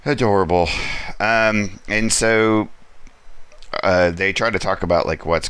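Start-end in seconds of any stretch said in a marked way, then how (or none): none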